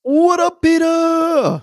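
high, loud hollered hello; shouted greeting. Pretend. Host greeting the last listener reviewer, shouting. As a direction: holler it out to welcome him.

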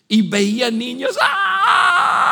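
mock scream of terrified kids; imitating kids screaming. Retelling kids coming off the ride, a mock scream. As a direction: let out a short scared kid's scream.

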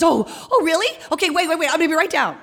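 put-on high, excited girl's voice. Girl tells canvassers she's coming, excited and high. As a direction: gush like a girl about to rush down. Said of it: "And she says".

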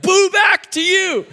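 loud, high, slow shout; defiant mock shout at the scarer; shouting back. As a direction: fling the boo right back, bold. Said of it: "I'll be like".

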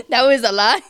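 laughing through the words. Real, not acted. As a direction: laugh while saying it. Laughing about her age.

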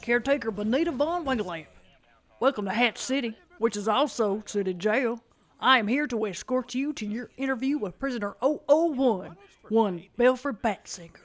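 caretaker voice. Acted.

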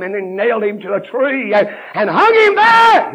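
climbs high and loud; rising preacher cry. Acted. Preacher describing the crucifixion, rising to a cry. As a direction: rise to a cry.

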